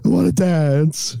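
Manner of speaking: high, slow sultry voice; fat lady voice. Retold or imitated. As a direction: drawl it out like a cartoon stripper. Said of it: "walking around the strip club like".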